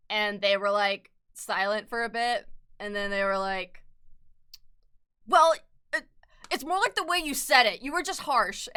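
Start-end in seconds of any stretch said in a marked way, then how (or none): 5.3-8.7 high, loud, prissy mock voice; mocking coworkers. Mimicking coworkers who call her harsh, high and loud.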